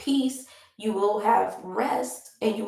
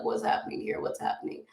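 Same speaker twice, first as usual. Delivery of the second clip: hushed, frightened mock whisper; scared whisper; 11 semitones lower, 9 dB quieter, faster than usual. People seeing an angel, mock frightened whisper. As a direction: whisper it like you're terrified of what you see.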